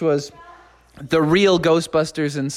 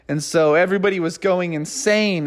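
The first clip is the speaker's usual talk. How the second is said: usual speaking voice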